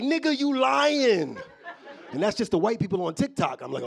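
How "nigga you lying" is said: high, loud, drawn-out heckle; hammed-up incredulous shout; mock disbelief. People disbelieving his claim, acted.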